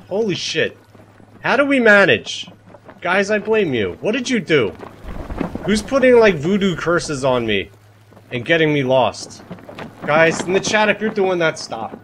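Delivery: exasperated. Real, not acted. Streamer blaming chat for getting lost again, exasperated outburst. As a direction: throw the blame at the chat, fed up.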